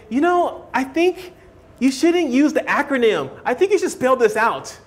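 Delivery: mocking a fussy teacher. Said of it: "They give you that kind of nonsense".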